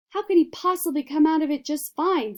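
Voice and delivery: high, loud angry snap; snapping. A narrator's reading. Mother snapping at Mr Wonka, angry. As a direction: snap back in disbelief. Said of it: "snapped Missus Gloop".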